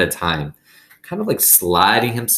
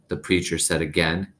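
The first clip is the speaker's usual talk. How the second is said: ordinary delivery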